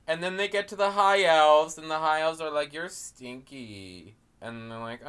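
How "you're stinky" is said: very soft, slow put-down; hushed, drawn-out imitated disdain; mock snooty insult. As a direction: murmur it slowly as a pretend snob. High elves insulting orcs.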